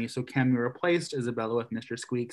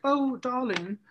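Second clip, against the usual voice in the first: much higher, prissy mother voice; hammed-up doting mother, pitched up high; posh mom voice; 6 semitones higher, 4 dB quieter than usual. Mom calls her son to the phone, high and prissy. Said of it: "the mom comes upstairs and he's like".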